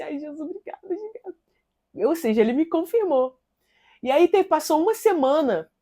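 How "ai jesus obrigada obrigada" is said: soft grateful squeal. Retold or imitated. Grateful exclamation leaving office.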